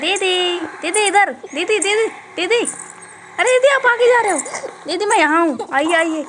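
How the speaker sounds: loud, high calling. Real, not acted. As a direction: yell to get her attention. Calling out to ghost sister.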